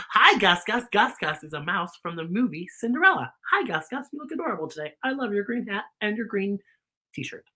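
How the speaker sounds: usual speaking voice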